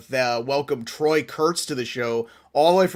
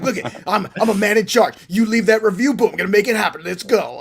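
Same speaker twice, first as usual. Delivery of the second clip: higher, louder mock pumped-up boss voice; imitating fired-up host; 6 semitones higher, 5 dB louder than usual. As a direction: puff up like a man in charge. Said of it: "he's sexy when he is fired up".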